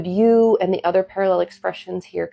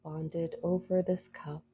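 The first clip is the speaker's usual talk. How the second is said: soft spa whisper; 11 dB quieter than usual. Acted. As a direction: lead them gently into calm. Leads a guided meditation.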